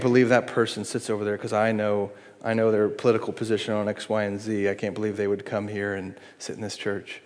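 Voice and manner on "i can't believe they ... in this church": much softer disapproving mutter; quietly scorn the neighbor's politics; hushed scandalized judgment; judgmental. Retold or imitated.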